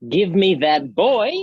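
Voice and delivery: forceful shouted demand; loud demand. Acted. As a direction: bark out the order. Oberon demands the boy.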